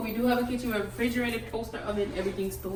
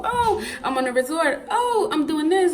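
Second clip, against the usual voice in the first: lightly mocking, rising pitch on each boast; mock vlogger gushing; typical vlogger; 6 semitones higher than usual. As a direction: imitate a showy resort vlogger. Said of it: "your typical".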